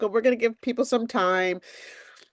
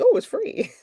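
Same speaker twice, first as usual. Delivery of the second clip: mock delighted; 12 semitones higher than usual. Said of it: "I'm like".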